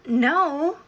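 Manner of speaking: loud, high, emphatic no; indignant denial. Retold or imitated. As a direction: blurt out an indignant refusal. Indignant retold denial of stomping.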